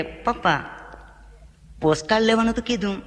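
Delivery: a son whining to his papa; child voice. Retold or imitated. Son complaining to father. (દીકરો પપ્પાને ધીમા સ્વરે ફરિયાદ કરે)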